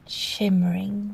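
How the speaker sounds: plain reading voice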